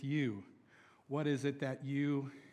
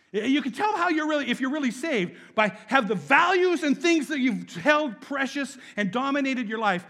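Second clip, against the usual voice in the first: louder, higher preaching push; fervent preaching; 7 semitones higher, 9 dB louder than usual. Real, not acted. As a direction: drive the point home hard. Preaching at full force.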